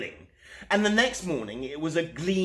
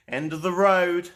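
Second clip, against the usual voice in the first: plain reading voice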